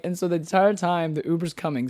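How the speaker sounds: usual speaking voice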